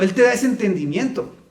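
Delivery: ordinary talk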